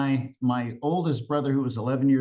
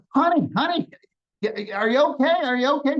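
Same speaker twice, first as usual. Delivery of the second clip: panicked calling; 10 semitones higher, 5 dB louder, faster than usual. Retold or imitated. Wife fell into the river.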